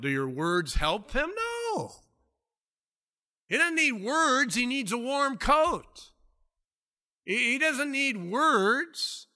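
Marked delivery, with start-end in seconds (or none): 1.4-9.3 high, urgent preacher's cry; impassioned preaching. Real, not acted. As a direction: plead the point with passion. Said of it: "preacher's impassioned rebuke".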